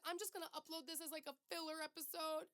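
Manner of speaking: high, wavering retold crying; mock tearful. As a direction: imitate her own teary rant, half-mocking. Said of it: "I was crying".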